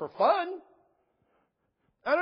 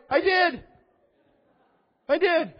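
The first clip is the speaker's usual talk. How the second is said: louder, higher, proudly owning it; emphatic admission; 9 semitones higher, 6 dB louder, slower than usual. Real, not acted. Pastor owning up to the student with a loud, proud admission. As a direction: punch it out with a grin. Admits calling evolution idiotic.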